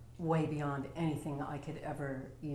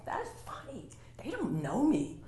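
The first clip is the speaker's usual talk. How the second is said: higher, amused, under the breath; laughing under mask; 8 semitones higher than usual. Retold or imitated. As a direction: chuckle it to yourself. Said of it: "And I'm laughing under my mask going".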